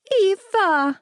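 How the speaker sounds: put-on high, drawn-out little-boy wail; tearful small child. A narrator's reading. Small boy running to her in tears, wailing. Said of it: "with tears streaming down his cheeks".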